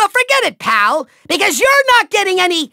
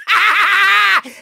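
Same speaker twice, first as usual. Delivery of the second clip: evil cackle; 2 semitones higher than usual. Acted. Villain mocking the trapped Skylander, gloating cackle. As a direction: cackle in triumph.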